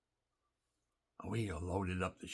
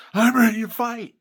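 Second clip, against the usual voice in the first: much higher and far louder defiant shout; Trump's defiant cry; 19 dB louder than usual. Retold or imitated. Veteran quoting a rally defiance after the shooting, loud defiant shout. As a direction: bellow it like a fist in the air. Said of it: "he sticks his fist up in the air and he says".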